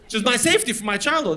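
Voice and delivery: normal talking voice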